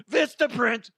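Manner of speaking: high blurted brand name; random shout. Real, not acted. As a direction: shout it out of nowhere.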